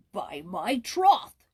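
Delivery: king's amused oath; exclaiming king. Acted. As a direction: declare it with relish. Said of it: "exclaimed the king".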